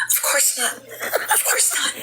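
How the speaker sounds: laughing. Retold or imitated. Quoted denial with laughter.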